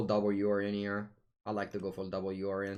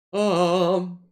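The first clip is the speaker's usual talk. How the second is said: loud high squeal; 9 semitones higher, 14 dB louder than usual. Pretend.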